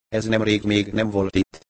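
plain reading voice

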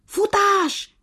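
commanding shout. A narrator's reading. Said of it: "vezényelt a nyúl".

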